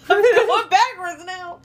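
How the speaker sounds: loud, high, drawn-out wail; flustered laughing yell. Real, not acted. Player walking backwards by mistake, flustered yell. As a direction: holler helplessly through laughter. Player losing control of movement.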